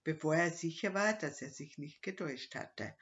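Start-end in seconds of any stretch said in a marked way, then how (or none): none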